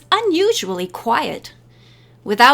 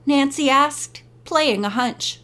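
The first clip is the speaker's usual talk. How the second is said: ordinary delivery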